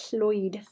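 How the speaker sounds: "Lloyd" with a hissy Welsh ll at the start; tongue on the roof of the mouth, hiss into "Lloyd"; careful Welsh demo of "Lloyd". Shown as a demonstration. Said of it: "and you go at the back".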